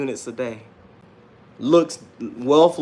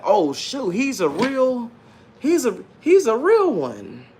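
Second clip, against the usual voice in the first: amazed onlookers; 9 semitones higher than usual. Retold or imitated. People reacting as their eyes open, imitated amazement. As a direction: imitate people whose eyes just got opened. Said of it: "and they're like".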